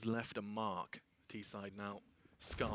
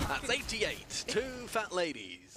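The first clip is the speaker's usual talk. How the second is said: bingo caller; 11 semitones higher, 7 dB louder, much slower than usual. Pretend. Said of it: "I sound like a bingo caller".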